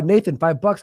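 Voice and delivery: ordinary delivery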